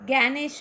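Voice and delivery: ज्ञानेश said with a hard "gya" opening; Hindi pronunciation. Shown as a demonstration. Teacher repeats the Hindi model word for students, hard "gya" opening. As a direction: start ज्ञानेश with "gya", as "gyanesh". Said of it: "Hindi में ज्ञानेश्वर कहना है".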